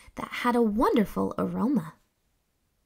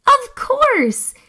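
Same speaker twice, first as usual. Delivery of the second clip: much higher and louder eureka; excited realisation; 8 dB louder than usual. Acted. Scout finding pickle flowers, thrilled eureka. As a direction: shout the discovery with joy.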